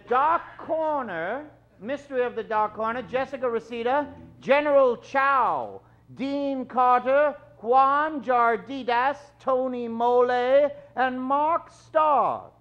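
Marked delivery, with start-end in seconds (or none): none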